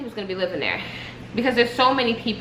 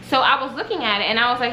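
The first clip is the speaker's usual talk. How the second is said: normal talking voice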